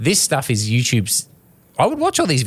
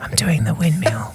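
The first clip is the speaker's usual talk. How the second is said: ASMR whisper; 5 semitones higher than usual. Pretend. Narrating a pretend windmill to co-hosts, ASMR whisper. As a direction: whisper the move as spoof ASMR. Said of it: "breakdancing A S M R".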